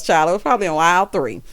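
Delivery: usual speaking voice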